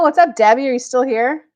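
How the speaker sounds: loud, high, upbeat hello to a viewer; cheery greeting. Real, not acted. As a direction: call out brightly to the chat. Greeting live viewer, next sign.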